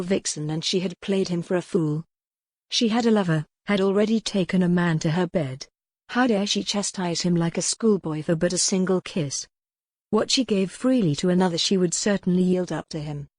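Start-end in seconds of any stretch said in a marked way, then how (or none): none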